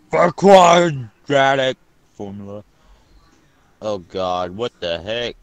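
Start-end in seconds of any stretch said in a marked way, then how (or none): 0.1-2.6 dramatic announcer voice. Pretend. Reading a test title dramatically.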